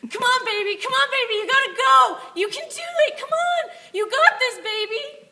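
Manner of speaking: very high, loud excited cheering; Donna cheering. Retold or imitated. Donna cheering a runner on, very high and loud. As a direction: scream encouragement like a wild fan. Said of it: "do Donna".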